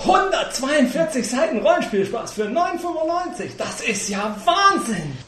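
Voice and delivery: high, loud fake astonishment; mock amazement. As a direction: gush like a stunned customer. Mock amazement at cheap price.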